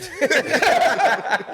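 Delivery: high, hearty cackling; real laugh. Real, not acted. Comic amused by rappers' brush-off, real laughter. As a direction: crack up at your own impression.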